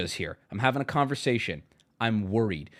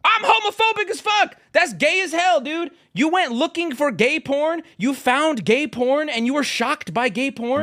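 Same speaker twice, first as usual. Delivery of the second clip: exasperated shouting rant; 12 semitones higher, 3 dB louder than usual. Real, not acted. Streamer yelling at Twitter critics to chat, exasperated shouting. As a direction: yell it in disbelief at the critics.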